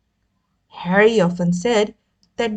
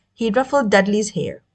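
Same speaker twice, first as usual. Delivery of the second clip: plain reading voice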